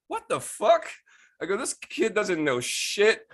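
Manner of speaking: very high, loud, drawn-out disbelief; incredulous outrage. Retold or imitated. Griping about the young roommate winning first place, incredulous. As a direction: squeal it in comic indignation.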